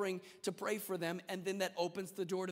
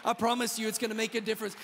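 rising to full preaching force; 3 semitones higher, 6 dB louder than usual. Acted. Preacher urging the church to grab the message, rising to full force. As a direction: build into a shout of conviction.

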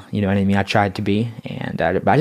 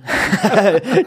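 laugh; 9 semitones higher, 4 dB louder than usual. Real, not acted. Laughs at own thievery confession.

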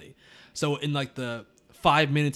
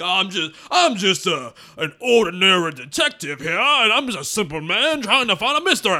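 mock a comically thick Southern accent; overdone Kentucky-fried Southern detective; 6 semitones higher, 7 dB louder than usual. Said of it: "his accent is borderline comical. It's so fucking thick".

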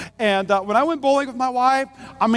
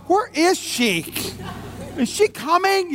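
mock nervous; 6 semitones higher, slower than usual. Hiding in shower from wife.

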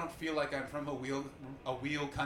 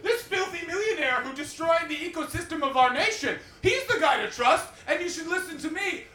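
high, loud comic outrage; incredulous rant; 9 semitones higher, 7 dB louder than usual. Acted. Comedian mocking an independence leader, incredulous high rant.

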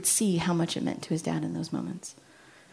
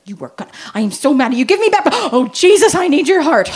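put-on high, loud childish outburst; a kid's tantrum that breaks into prayer; mock angry child; 8 semitones higher, 12 dB louder than usual.

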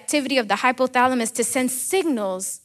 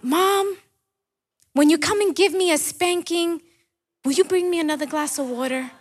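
put-on higher child's voice calling out to mom, quicker at the end; a hint of a boy calling from bed, hurrying the last question; little boy calling out; 6 semitones higher than usual. Preacher playing the boy who calls out to mom for water, high and eager. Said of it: "the boy called out".

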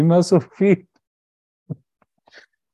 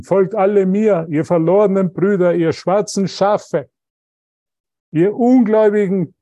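raised, loud preacher's proclamation; mock biblical prophet; 4 semitones higher, 3 dB louder than usual. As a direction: ham up a fire-and-brimstone prophet. Mocking a preacher's call to follow.

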